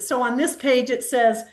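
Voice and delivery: ordinary delivery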